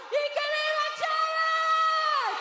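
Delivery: high, loud, slow shout; rally shout. Real, not acted. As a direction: belt out the cheer. Closing rally cheer.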